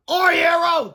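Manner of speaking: high, loud cheer; hammed-up character shout; adoring shout. A narrator's reading. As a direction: hail the rescuer. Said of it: "the boy shouted".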